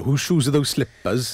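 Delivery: put-on slow playful Welsh accent; quoting in a Welsh accent. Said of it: "my Welsh accent".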